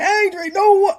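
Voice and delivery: high whine, cut off; mock tearful whine. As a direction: imitate yourself wailing in panic. Mocking her own earlier wail.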